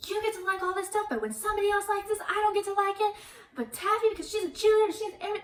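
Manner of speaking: high-pitched, fast indignant rant; acting out a squealed, racing tantrum; mock raging. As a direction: rattle it off high in hammed-up outrage. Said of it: "she just starts raging".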